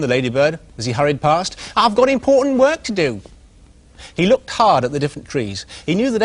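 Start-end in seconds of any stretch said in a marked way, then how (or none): 1.8-3.2 high, self-important little pig voice; proud little pig. Acted. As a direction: puff up with pride as the little pig. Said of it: "he called to his friend".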